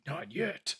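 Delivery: lightly voiced superhero impression; a hint of a caped crusader; Batman. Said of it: "I've seen a Batman one".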